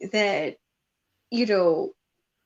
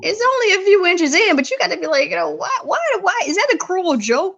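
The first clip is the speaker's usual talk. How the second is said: high, pleading mock outrage; mock outraged question; 6 semitones higher than usual. Complaining to her own body, high and pleading. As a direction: whine it up high like a cheated lover. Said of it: "I was like".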